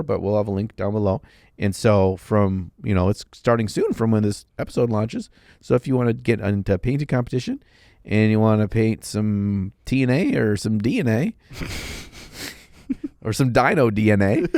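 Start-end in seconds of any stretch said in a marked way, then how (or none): none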